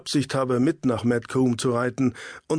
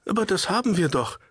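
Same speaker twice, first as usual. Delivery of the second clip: put-on higher, a boy's indignant protest; 7 semitones higher, faster than usual. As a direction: push back, it's not our fault. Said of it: "protestierte ein".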